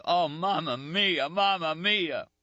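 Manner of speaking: high, slow moaning through pain; anguished drawn-out moan. Acted. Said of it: "He bit his arm and moaned".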